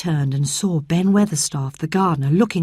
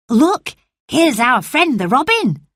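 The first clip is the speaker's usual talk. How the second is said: excited call; 8 semitones higher than usual. Acted. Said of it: "Dickon cried".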